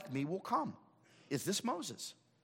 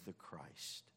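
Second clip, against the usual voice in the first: hushed; 9 semitones lower, 18 dB quieter than usual. Acted. End of quoted confession.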